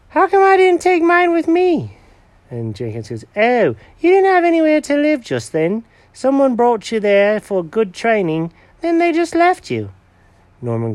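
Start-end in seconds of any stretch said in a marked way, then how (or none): 0.1-2.0 very high, puzzled builder cat; Norman the builder voice. Acted. Norman's character voice. 3.4-9.9 high, eager cat character voice; proud cat showing Norman the bed, high and eager; Jenkins the cat voice. Acted.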